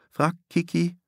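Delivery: plain reading voice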